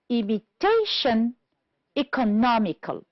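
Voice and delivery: "imitation, economical" stressed on TA and NOM; stress on third syllable. Shown as a demonstration. Teacher listing words for the table, "imitation, economical" stressed on third syllables. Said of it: "stressed on the third syllable".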